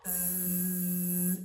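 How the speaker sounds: "Zzz" as a low, long bee-like buzz. Shown as a demonstration. Mother showing her son the Z sound like a bee. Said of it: "o som dele é assim".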